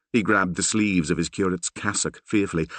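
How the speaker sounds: plain reading voice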